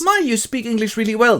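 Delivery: high, put-on admiring voice; impressed Harker. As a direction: compliment your host in a high voice. Harker compliments the Count.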